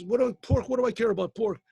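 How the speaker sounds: indignant protest, rising pitch; yelling customer. Retold or imitated. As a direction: customer yelling at the owner about chametz. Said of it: "What are you yelling about".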